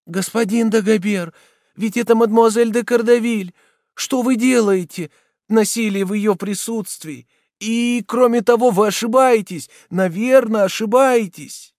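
high, loud anxious remonstrance; pleading young woman. A narrator's reading. Hunchback girl restraining the soldier, anxious pleading.

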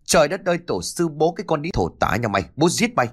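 high-pitched outburst of fury; enraged roar. A narrator's reading. Son seeing his mother abused, enraged roar. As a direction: burst out cursing, high and quick. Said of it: "Sự lúc này điên tiết gầm lên".

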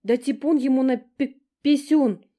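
high, loud breathless wailing apology; chirping lament. A narrator's reading. Younger sister apologising to everyone, chirping lament. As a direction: babble your apologies. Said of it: "зачирикала, запричитала Стежка".

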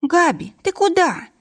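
high-pitched call, voice leaping up; calling after him. A narrator's reading. Lena calls after Gabriel as he leaves, high call. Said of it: "крикнула вслед Лена".

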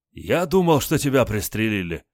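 panting, relieved and beaming; breathless with a big grin; out of breath. Acted. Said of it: "запыхавшийся майор".

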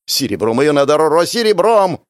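loud urgent rush; frantic babble. Acted. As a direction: blurt it out in a panic. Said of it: "затараторил Максимилиан".

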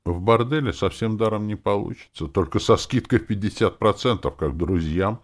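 matter-of-fact negotiator; businesslike. A narrator's reading. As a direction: state the price like business. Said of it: "деловито заметила Роза".

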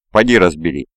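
ordinary delivery

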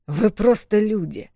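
slow, scornful dismissal; disdainful. Acted. Wolf Миафор dismissing the humans with disgust. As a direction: look down on them. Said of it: "с каким отвращением он это произнёс".

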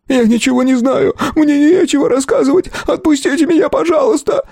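high-pitched hysterical pleading; frantic scream. A narrator's reading. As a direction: cry out desperately, begging to be let go. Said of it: "неистовым криком бобра". (неистовый крик сквозь рыдания)

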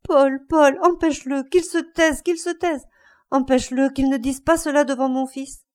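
high, urgent desperate plea; heart-rending cry. A narrator's reading. Mother begging Paul to silence her husband, anguished cry. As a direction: cry out to stop him, pitched well up. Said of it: "La femme cria d'une voix déchirante".

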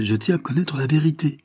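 high, pressing, rising to loud; overwrought. A narrator's reading. Daughter begs the doctors for the truth, high and overwrought. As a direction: push the pitch up, near breaking. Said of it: "continua-t-elle avec surexcitation".

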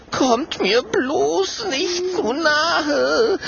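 very high, slow, trembling voice; drawn-out fearful wail; quavering moan. A narrator's reading. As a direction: moan it shakily in terror. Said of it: "stöhnt Clementia mit zittriger Stimme".

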